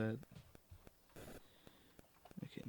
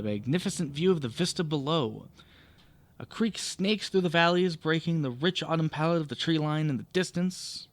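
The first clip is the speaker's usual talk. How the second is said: louder, animated read-aloud narration; projected storytelling voice; story narration; 3 semitones higher, 9 dB louder than usual. Acted. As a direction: read it out like a game master setting the scene. Reads the adventure text aloud.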